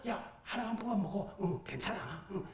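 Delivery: very soft, conspiratorial urging; tempting inner voice. Acted. Temptation urging him to pull just one, hushed coaxing. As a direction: murmur it like a secret nudge. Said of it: "이 속에서 또 그런 마음이 드네요".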